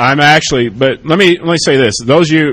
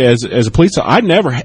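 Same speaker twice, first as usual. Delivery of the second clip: ordinary talk, nothing marked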